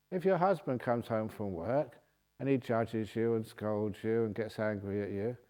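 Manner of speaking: ordinary talk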